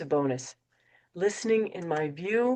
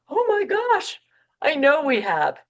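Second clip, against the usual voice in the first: high, loud panicked "Oh my gosh"; panicked gasp; 10 semitones higher, 6 dB louder than usual. Acted. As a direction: blurt it out in sudden alarm. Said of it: "instead of panicking".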